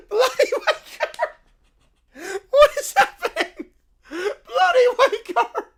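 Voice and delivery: loud, high laughing yells; shrieking laughter. Real, not acted. Streamer losing it in the insult war. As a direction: howl the words while laughing.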